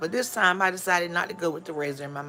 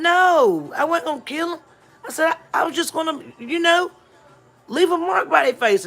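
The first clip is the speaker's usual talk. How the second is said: put-on much higher, louder kid's voice; younger self answering mom; 11 semitones higher, 6 dB louder than usual. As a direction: squeak it out like a kid caught out. Child answering angry mother.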